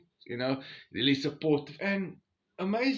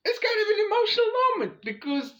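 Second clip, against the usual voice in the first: choked up; 5 dB louder than usual. Real, not acted. Saying goodbye to his wife.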